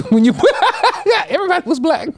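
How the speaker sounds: high laughter bursting through the words; giggling, pitch jumping up; laughing while talking. Real, not acted. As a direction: crack up mid-sentence and push on. Breaks up at own joke.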